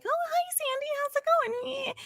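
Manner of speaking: high sugary imitation of Frenchie; imitating Frenchie. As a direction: chirp it like a sweet teen girl. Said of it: "Frenchie comes over and is like".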